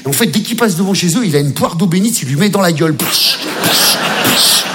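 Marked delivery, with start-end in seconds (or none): 3.0-4.6 imitated spraying hiss, psh psh; holy water sprinkle. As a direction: spit out the spraying noise. (bruit de pulvérisation imité)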